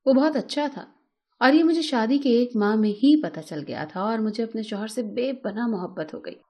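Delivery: ordinary delivery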